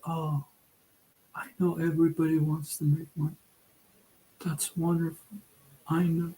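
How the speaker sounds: mock sweet reassurance, breathy-free but soft; sugary fake encouragement. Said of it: "I'm not one of those that say".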